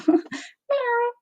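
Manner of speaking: high, soft mew with a laugh; cat meow. Retold or imitated. Retelling the cat's meow to viewers, high and soft. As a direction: copy the tiny cat cry.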